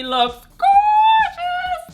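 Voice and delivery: slow, loud, high gushing; drawn-out gushing. Real, not acted. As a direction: stretch out gorgeous with delight. Stretched-out rave.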